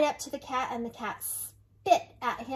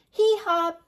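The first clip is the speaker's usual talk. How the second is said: loud high braying hee-haw; big hammed-up donkey bray; 6 semitones higher, 8 dB louder than usual. Animals scare off the robbers.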